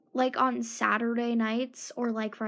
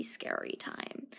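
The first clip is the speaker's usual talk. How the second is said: whisper; 14 dB quieter, slower than usual. Real, not acted. Trailing scared whisper.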